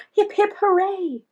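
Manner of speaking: high, loud hooray; cheer. A narrator's reading. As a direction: celebrate the rescue together. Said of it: "his friends cheered".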